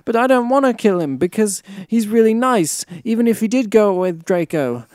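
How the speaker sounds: high, loud, distraught wail of words; hammed-up tearful outburst. A narrator's reading. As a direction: plead it melodramatically, close to tears. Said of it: "I burst into tears".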